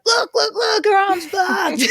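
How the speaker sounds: high, laughing excited voice; excited friends. Retold or imitated. Pub friends showing everyone her arm, high and laughing. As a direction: point and crow like tipsy mates.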